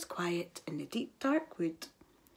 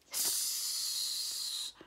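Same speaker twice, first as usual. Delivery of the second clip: long breathy hiss; snake hiss; 9 dB quieter than usual. A narrator's reading.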